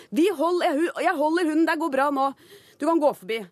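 dog owner calling back. Retold or imitated. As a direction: shout reassurance across the street. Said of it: "Så hører jeg eieren rope".

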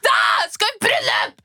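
hammed-up crying wail; stressed crying. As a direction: sob it out in panic. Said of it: "Jeg er sånn".

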